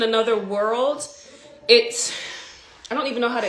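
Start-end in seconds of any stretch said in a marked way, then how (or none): none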